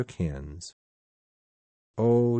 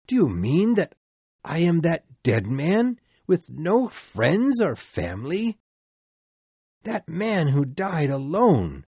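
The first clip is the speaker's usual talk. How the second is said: horrified pleading; 7 semitones higher, 4 dB louder than usual. Acted. Scrooge sees his own grave.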